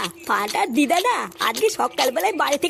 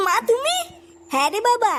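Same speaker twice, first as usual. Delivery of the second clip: usual speaking voice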